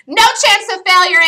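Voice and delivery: "No chance of failure" shouted high and loud; excited declaration. Real, not acted. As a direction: belt out "No chance of failure" with confidence. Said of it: "every single time I say".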